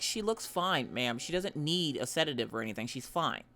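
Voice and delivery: calm, low and quiet doctor; low, soft vet voice. Retold or imitated. Vet calmly telling the owner the dog is fine, low and soft. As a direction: settle her down flatly.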